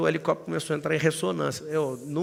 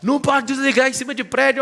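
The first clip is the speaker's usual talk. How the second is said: loud, higher shouted reply; retold shout; 9 semitones higher, 7 dB louder than usual. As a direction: pilot shouting from the cockpit. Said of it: "falava de dentro do helicóptero". (grito do piloto de dentro do helicóptero)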